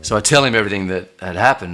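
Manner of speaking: normal talking voice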